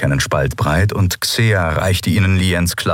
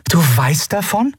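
surprised question; 11 semitones higher than usual. Acted. Endriel überrascht.

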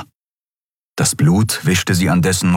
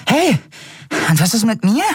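indignant little creature. Acted. Small creature demands credit too.

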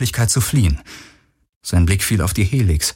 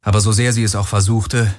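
ordinary delivery

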